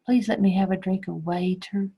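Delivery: "waiter" with a long A in place of water; mock wrong sentence. Shown as a demonstration. Teacher plays a wrong reading to the class. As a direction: ask for a drink of "waiter", long A. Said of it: "No, we don't say it that way".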